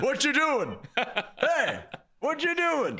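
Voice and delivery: put-on high, loud old farmer voice, laughing; elderly farmer. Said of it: "I sounded like an elderly farmer".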